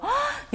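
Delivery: sharp high gasp of delight; amazed gasp. Shown as a demonstration. Teacher gasping in delight at the student's progress, amazed.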